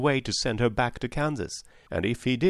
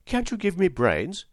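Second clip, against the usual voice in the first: higher, lightly voiced appeal; pleading Scarecrow; 8 semitones higher, 3 dB louder than usual. A narrator's reading. Scarecrow asks the wizard for brains, lightly pleading. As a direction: ask eagerly in a higher character voice.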